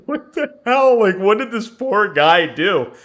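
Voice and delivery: high-pitched, mock-horrified protest; appalled disbelief. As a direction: replay your bewildered outrage, voice jumping up. Retells own horrified reaction to killing.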